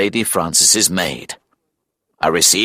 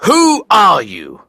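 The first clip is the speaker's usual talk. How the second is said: high, loud, drawn-out challenge; confronting demand; 10 semitones higher, 5 dB louder, slower than usual. Acted. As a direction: challenge the stranger, slowly and loudly. Narrator confronts the stranger.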